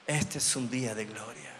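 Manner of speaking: soft, reverent echo of the proclamation; hushed repeat. Shown as a demonstration. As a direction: let the line sink in, almost to yourself. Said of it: "repeated proclamation".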